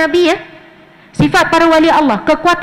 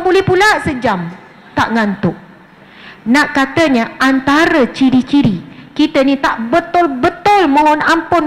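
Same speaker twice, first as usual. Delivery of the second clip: ordinary talk, nothing marked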